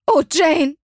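hoher, lauter verzweifelter Ausruf. A narrator's reading. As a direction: jammere theatralisch auf. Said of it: "sagte Misses Bennet verzweifelt". (Mutter jammert verzweifelt über Janes Trennung)

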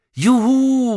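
high, loud whoop; jubilant shout. A narrator's reading. Tom riding the pretend dragon, jubilant shout. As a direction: whoop as if flying. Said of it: "rief Tom".

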